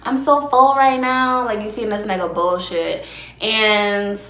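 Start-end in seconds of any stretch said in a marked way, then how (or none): 0.0-1.4 high, slow sing-song moan; hammed-up pleasure voice, much higher; mock moaning. Shown as a demonstration. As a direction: coo it high and drawn out. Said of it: "you let out, like, a little moan".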